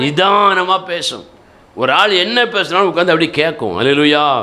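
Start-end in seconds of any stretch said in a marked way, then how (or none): 0.0-1.3 slow demonstration with a long drawn first word; deliberately slow; measured, unhurried. Shown as a demonstration. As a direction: stretch out நிதானமா to show calm speech. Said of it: "எப்படி பேசும்?".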